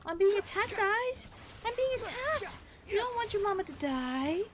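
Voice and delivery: high loud playful alarm; playful yelp. Real, not acted. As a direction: squeal at the attacking cat. Cat attacking her.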